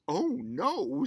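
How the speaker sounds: high, loud dismayed "oh no"; shocked cry. Acted. Mother discovering the colored daughter, shocked cry. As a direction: gasp it out in alarm.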